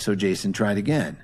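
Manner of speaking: usual speaking voice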